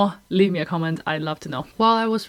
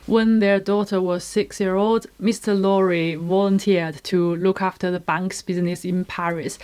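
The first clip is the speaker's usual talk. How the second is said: ordinary delivery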